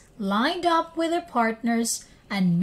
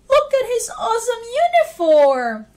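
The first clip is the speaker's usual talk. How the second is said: put-on much higher, louder and fast, thrilled kid; excited child; 12 semitones higher, 7 dB louder, faster than usual. A narrator's reading. David to classmates about the firefighter, high excited child voice. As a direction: gush about the uniform like an awed child.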